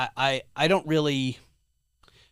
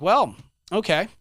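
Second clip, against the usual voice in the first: resigned sarcasm; 7 semitones higher, 8 dB louder, slower than usual. Retold or imitated. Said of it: "And I'm like".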